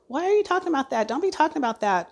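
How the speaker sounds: fast, high alarmed protest, reliving the panic; begging him to stop, voice jumping up; panicked pleading. Retold or imitated. Wife on the phone pleading with her sick husband, alarmed and fast. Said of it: "like, freaking out".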